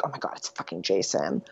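low, very soft mock-scared quote; quietly imitated dread; hushed alarm. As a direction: drop to a hushed undertone, imitating scared characters. Characters hear noise, fear Jason.

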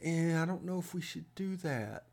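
meek and mousy, barely daring to object; mousy, sheepish voice. Acted. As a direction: shrink into a timid, hushed little voice. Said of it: "with a very mousy, sheepish, sheepish voice".

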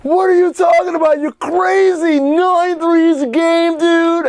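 high, stretched mock disbelief; mock outraged crowd. Host imitating everyone scoffing at the article, high mock outrage. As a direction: mimic incredulous critics way up high. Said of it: "everyone was like".

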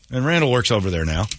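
usual speaking voice

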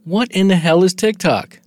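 high, loud, baffled outburst; hammed-up bewildered alien; mock shock. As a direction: act out an alien stunned by the app. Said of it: "they come back five years later".

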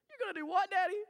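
put-on high-pitched small child asking Daddy; high, put-on little kid voice; imitated little daughter. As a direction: mimic a child's sudden astonished question. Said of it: "her whole demeanor changed, and she said".

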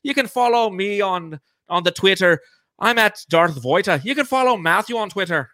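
put-on lightly held Irish accent; Irish lilt, a bit high. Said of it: "Let's do an Irish accent".

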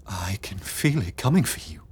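hushed and pressing, half-whispered; urgent whisper. Acted. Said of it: "Beatrix whispered urgently".

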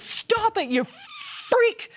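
high snapping teacher voice; mean teacher. Retold or imitated. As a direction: bark it like a nasty teacher. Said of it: "the teacher comes over and goes".

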